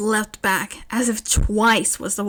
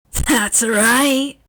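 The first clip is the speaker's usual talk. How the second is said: loud, manic villain yell; crazed yell; 3 semitones higher, 5 dB louder than usual. Acted. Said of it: "Dobby yelled".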